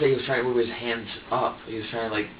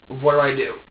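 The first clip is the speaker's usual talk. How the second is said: desperate cry; 8 dB louder than usual. Retold or imitated. Desperate quote to crying baby.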